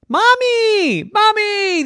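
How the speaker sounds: put-on very high, loud child's call; calling for Mommy. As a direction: cry out for mother like a trapped kid. Kids call for mother.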